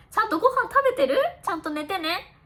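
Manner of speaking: quick, high, fussing questions; rapid caring friend voice; worried friend. Retold or imitated. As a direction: fuss over a thin, overworked friend. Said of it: "私が絶対言う言葉".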